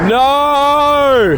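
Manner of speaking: long, high, drawn-out "no"; anguished cry. Real, not acted. Photographer checks missed shots, anguished cry. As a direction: howl it out in dismay.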